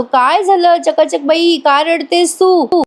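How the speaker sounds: slow, high-pitched concern; coaxing. Retold or imitated. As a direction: coax someone in tears, slowly. Voices husband soothing crying wife.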